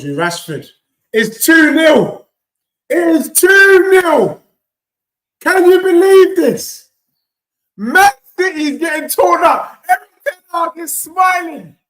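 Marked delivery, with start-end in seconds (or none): 1.1-6.9 loud, slow, high disbelief at the goal; excited yell. Real, not acted. Fan yelling at the screen as his team scores, overjoyed. Goal reaction during watch-along. 7.8-11.8 high gleeful shouting; excited shout. Real, not acted. Fan shouting to his stream audience, gleeful. Celebrating goal live.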